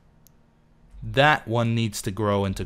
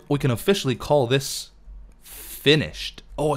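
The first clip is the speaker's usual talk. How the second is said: usual speaking voice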